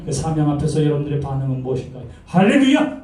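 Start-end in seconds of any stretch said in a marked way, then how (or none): 2.3-3.0 bright, raised shout of hallelujah; eager hallelujah. Pretend. Preacher mimicking an eager believer answering God's call, raised cheer. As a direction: jump at the call.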